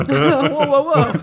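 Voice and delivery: high, loud laughing whoa; laughing high alarmed whoa; mock whoa. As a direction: slam the brakes playfully. Mock halting India.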